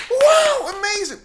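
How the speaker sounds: loud, very high gushing; hammed-up cheering in a high voice; mock amazement. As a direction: imitate a wildly over-impressed crowd. Imitating passengers applauding the pilot.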